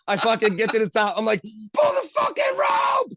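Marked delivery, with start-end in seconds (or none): 1.7-3.1 very high, drawn-out strained scream; desperate shout. Retold or imitated. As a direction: scream for help as you break the surface. Retold desperate cry on surfacing.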